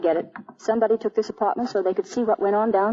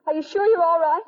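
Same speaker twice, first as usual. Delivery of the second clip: ordinary delivery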